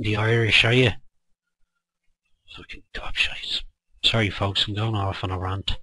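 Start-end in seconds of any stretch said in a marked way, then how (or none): 2.6-3.6 whispered, disgusted muttering; under-breath curse. Real, not acted. Speaker cursing critics under his breath, whispered. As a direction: spit it out in a whisper to yourself. Muttered insult ending a rant.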